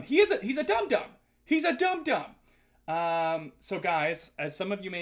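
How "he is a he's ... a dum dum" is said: high, loud playful name-calling; mock insult. Streamer calling a viewer's sweetie dum-dum on request, mock indignation. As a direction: exclaim it in exaggerated indignation.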